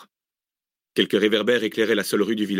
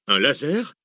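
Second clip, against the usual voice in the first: raised, rising question of surprise; astonished; 5 semitones higher, slower than usual. A narrator's reading. Map reader echoing the researcher's discovery, astonished. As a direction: echo it in astonishment, lifting at the end. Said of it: "s'étonna Arsène".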